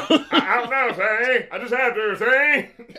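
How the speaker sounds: put-on high, loud old gangster voice; exaggerated cartoon intonation. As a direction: play the nervous movie gangster, see. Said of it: "why are you talking like that".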